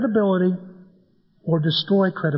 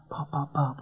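soft squeezes; 4 semitones lower, 10 dB quieter than usual. Shown as a demonstration. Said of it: "which means, "I love you".

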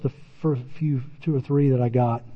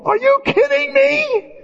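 much higher, slow squealing "are you kidding me"; exasperated disbelief; slower than usual. Retold or imitated. Tim staring at his sliced-open hand, high exasperated squeal. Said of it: "and I go".

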